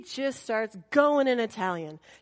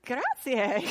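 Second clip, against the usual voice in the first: laughing; 8 semitones higher than usual. Retold or imitated. Polite thanks then laugh.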